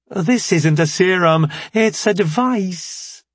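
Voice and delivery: high, slow, bright chirp; perky young scientist. Acted. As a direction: sweetly introduce yourself. Scientist corrects them.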